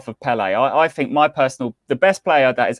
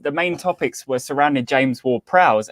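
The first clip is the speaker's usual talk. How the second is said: normal talking voice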